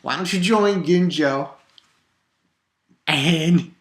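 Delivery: mocking taunt. Taunting the villain, laughing.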